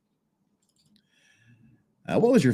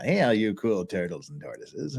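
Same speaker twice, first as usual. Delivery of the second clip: put-on country accent; 4 semitones lower, 5 dB quieter than usual. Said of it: "making him sort of sound like he's from Joe Country".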